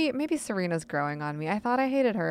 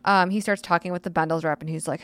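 normal talking voice

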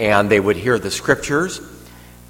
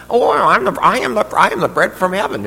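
high, loud mock Brooklyn accent; hammed-up Brooklyn accent, pitched up; 11 semitones higher, 5 dB louder than usual. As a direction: imitate a Brooklyn guy making grand claims. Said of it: "you've got a Brooklyn accent".

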